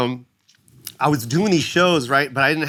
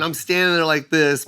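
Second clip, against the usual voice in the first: ordinary talk, nothing marked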